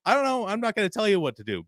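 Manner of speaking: exasperated, quick retort; mild mock impatience. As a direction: brush him off, fast. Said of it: "And C M Punk says".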